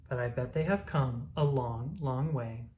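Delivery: usual speaking voice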